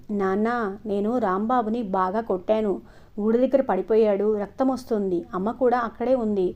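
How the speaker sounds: ordinary delivery, nothing marked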